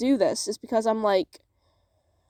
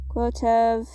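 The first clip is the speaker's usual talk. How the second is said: ordinary talk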